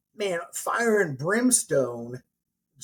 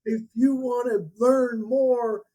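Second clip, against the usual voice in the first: mock sales guru; 8 semitones higher, 4 dB louder than usual. Guru sending the crowd to buy, hammed-up pitch. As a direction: push them to the back table.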